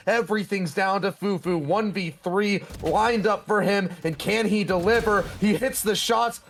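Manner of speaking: rising, excited play-by-play; esports caster. Acted. Live play-by-play of clutch.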